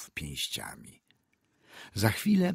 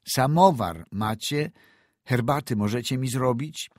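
doctor repeats louder, higher; louder repeated question; 5 semitones higher, 5 dB louder than usual. A narrator's reading. Doctor repeats "Samowar macie?" to the scared old woman, louder. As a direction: ask again, louder, to a frightened woman.

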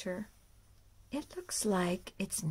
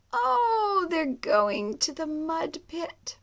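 higher, louder and slow; excited discovery; 6 semitones higher, 8 dB louder, slower than usual. Acted. Reading to a child, excited about the mud pit. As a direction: delight for the child listener.

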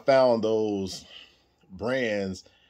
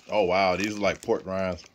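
talking while chewing snack; speaking between bites; mouth full, chewing; 3 semitones lower than usual. Real, not acted. Seen 2: Holds pork rind, lips pursed chewing, bites more at 1.4.

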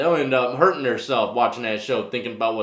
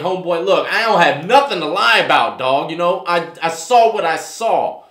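firm pushback; 4 semitones higher, 8 dB louder than usual. Retold or imitated. Standing firm against aggressive Birdman.

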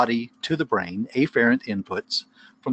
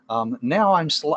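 ordinary talk

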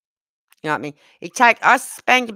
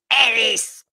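higher, sharper call of the name; imitated Brummy shout; 5 semitones higher than usual. Granddaughter calling a second time after no answer, mock Brummy shout. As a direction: mimic a little girl yelling in Brummy. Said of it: "shouted at him".

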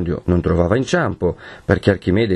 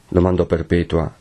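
ordinary delivery, nothing marked

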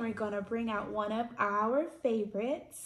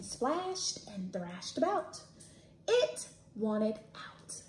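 reading a picture book aloud; 4 semitones higher, 4 dB louder than usual. A narrator's reading.

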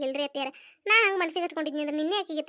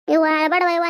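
louder urging to stop crying; 8 dB louder than usual. Acted. Elder sister urges the crying girl, louder, to stop. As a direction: firmly tell her to stop crying.

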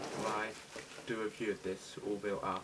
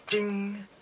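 ding sound; 11 semitones higher, 9 dB louder than usual. Pretend. Presenting the catalogue.

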